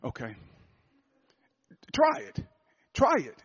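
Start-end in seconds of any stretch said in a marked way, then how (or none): 1.9-3.3 high, bright dare; high challenge. Acted. Preacher daring the congregation, much higher. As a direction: dare them to try it.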